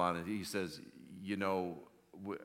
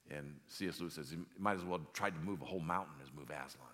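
ordinary delivery

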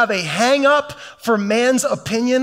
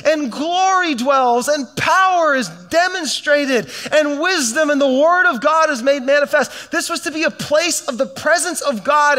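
building preaching; 5 semitones higher than usual. Acted. Preaching climax on temple intent.

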